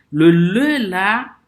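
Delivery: "le le là" slow and loud, le held high; insistent teacher stressing "le"; stressed le. Shown as a demonstration. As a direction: lean hard on "le", drawn out. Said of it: "j'insiste sur ça".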